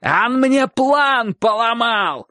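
high, drawn-out indignant wail; shouting. A narrator's reading. Dog-catcher complaining about his ruined plan, shouting. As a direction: protest in a high, stretched yell. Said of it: "кричал Собаколов".

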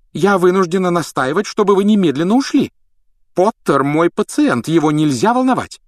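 high, loud, angry scolding; cross. A narrator's reading. Matron ordering visitors away from her patient, cross. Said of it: "сердито вмешалась мадам Помфри".

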